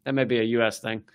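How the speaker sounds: ordinary talk, nothing marked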